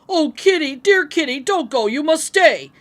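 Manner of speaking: loud, pleading calls after the cat; shouting after her. Acted. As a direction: call the running cat back. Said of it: "we shouted and cried".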